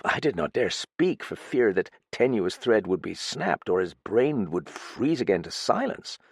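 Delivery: ordinary delivery, nothing marked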